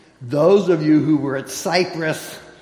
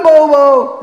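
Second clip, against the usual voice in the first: Bobo! called out much higher and much louder, falling at the end; Bobo! hollered to wake the lazy horse; 10 semitones higher, 12 dB louder than usual. Retold or imitated. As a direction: yell Bobo! across the yard. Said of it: "until I holler at him and go".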